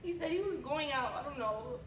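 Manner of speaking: hushed, frightened plea for help; soft scared girlfriend on the phone; scared and pleading. Acted. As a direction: beg quietly to be taken in.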